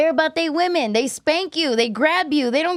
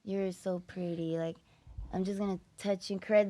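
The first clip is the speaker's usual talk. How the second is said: much softer, hushed, syrupy mock tenderness; mock sweet lover; 5 semitones lower, 13 dB quieter than usual. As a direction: coo it like a soppy boyfriend, quietly.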